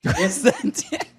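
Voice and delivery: slow, high and laughing. Pretend. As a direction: draw it out while laughing. Catchphrase repeated laughing.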